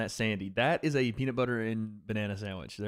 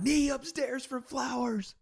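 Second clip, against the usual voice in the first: excited call; slower than usual. Real, not acted. Hype commentary on fight.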